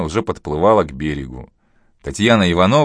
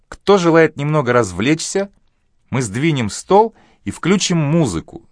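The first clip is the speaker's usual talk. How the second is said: higher, bright inviting voice; cheerful hostess; 9 semitones higher than usual. Acted. Galina inviting guests.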